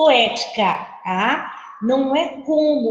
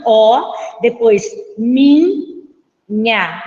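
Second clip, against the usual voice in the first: "Ó" and "minha" pulled apart, very slow; separated syllables; 4 dB louder, much slower than usual. Shown as a demonstration. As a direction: stretch "Ó, depois minha" into separate sound blocks. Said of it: "a sonoridade, ela tá bem separada do minha".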